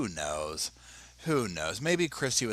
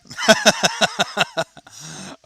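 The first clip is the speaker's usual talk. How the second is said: loud laugh; 11 semitones higher, 11 dB louder than usual. Real, not acted. Laughs at own rhyme.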